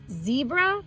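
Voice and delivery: ordinary delivery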